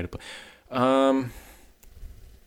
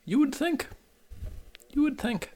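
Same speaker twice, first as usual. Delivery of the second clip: ironic high squeak; 5 dB quieter than usual. Real, not acted. Ironic aside about Raven.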